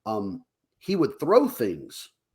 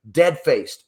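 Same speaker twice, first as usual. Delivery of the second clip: ordinary talk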